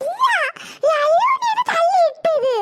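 put-on high, slow childish protest. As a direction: whine the denial.

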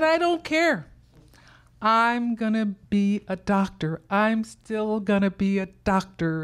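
put-on a girl who won't be told no; defiant child. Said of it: "I said".

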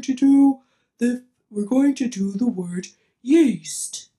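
lightly held silly dopey voice while explaining. Shown as a demonstration. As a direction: keep the goofy voice going as you teach. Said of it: "do it in a dopey voice".

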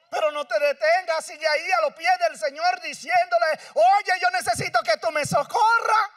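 clamor de predicador, alto y fuerte; predicación a todo pulmón. Acted. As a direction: predica a gritos al pueblo. Preaching at full force. (predicador clamando con fuerza)

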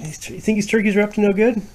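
high fast teasing question; playful question. Real, not acted. Parent playfully quizzing the child, high and fast.

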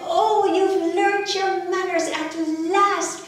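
high, loud, delighted mother; a proud mum praising, pitched high. Acted. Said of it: "She was so delighted".

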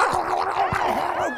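loud, high mauling noise; imitated dog attack. Storyteller acting out the dog on his cousin, savage.

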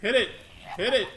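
high loud panicked yell; panicked shout. Real, not acted. Urging to fight creature.

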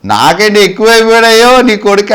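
high, loud mock reproach; sarcastic taunt. God mocking his devotee over his son, loudly sarcastic. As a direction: taunt him with a wailing jibe. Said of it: "భక్తుడిని ఎటకారం చేస్తున్నాడు".

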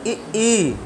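ई, ई said with the tongue front pressed back; vowel demo. Shown as a demonstration. Teacher showing students how to say ई. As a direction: hold the tongue and sound ई, ई clearly. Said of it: "बोलो, जीभ रोक के बोलो".

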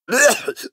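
spluttering cough. Acted. Dwarf choking on his gryphon's feathers, spluttering cough. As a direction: cough and splutter on something in the mouth.

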